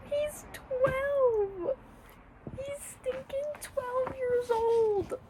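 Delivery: high, slow, tearful disbelief; crying. Real, not acted. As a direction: sob it out in disbelief. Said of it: "I'm gonna cry".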